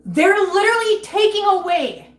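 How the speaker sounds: loud, tearful outrage; angry rant. Real, not acted. Streamer furious at viewers about pet fees, loud and tearful. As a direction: pitch up, voice cracking with anger. Ranting about losing money for cats.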